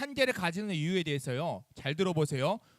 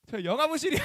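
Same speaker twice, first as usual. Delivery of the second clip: put-on kid answering; 7 semitones higher, 5 dB louder than usual. 아이 목소리 재연.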